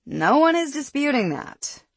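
ordinary delivery, nothing marked

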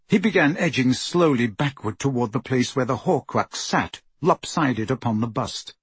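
usual speaking voice

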